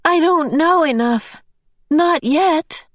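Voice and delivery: high anguished despair; cracking tearful heartbreak. Acted. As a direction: voice breaking, close to tears. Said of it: "cried in an anguished voice".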